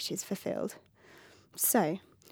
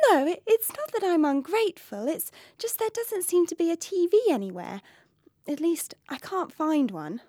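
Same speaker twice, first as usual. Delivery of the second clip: princess voice; 6 semitones higher than usual. Acted.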